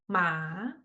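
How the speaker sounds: "Ma" starting low and soft, then rising; second tone. Shown as a demonstration. Teacher closing second tone demo, "Ma" rising. Second tone demonstration after scale.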